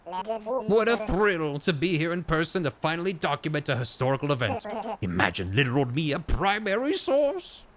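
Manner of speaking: theatrical, swooping historian voice; excitable scholar character. Acted. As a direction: relish each phrase like a thrilled chronicler.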